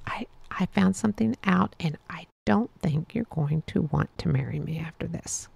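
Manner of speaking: hushed, barely voiced confession; unvoiced confession whispered to a fiancée; retold whisper. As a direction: whisper it like a scared secret. Donald confessing, upset and crying.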